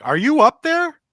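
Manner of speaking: high, loud call up the stairs; yelling up. Retold or imitated. Husband yelling up the stairs to his wife, high and loud. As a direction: shout up to the next floor. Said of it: "I remember yelling up".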